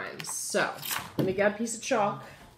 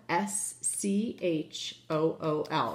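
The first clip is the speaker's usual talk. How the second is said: slow spelling out; much slower than usual. Shown as a demonstration. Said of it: "let's say it one more time".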